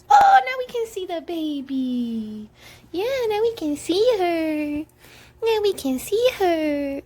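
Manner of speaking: cooing delight at seeing her. Real, not acted. Mom coos at her baby.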